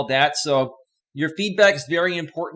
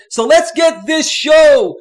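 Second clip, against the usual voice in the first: huge, high announcer shout; old show intro; 12 semitones higher, 13 dB louder, much slower than usual. Shown as a demonstration. Host kicks off the livestream the old way, roaring announcer. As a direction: stretch every word out to a roaring, drawn-out finish. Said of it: "start this show off like I used to".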